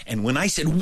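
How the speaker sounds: ordinary delivery, nothing marked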